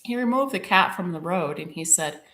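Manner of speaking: ordinary delivery, nothing marked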